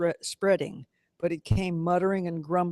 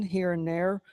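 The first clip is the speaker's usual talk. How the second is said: usual speaking voice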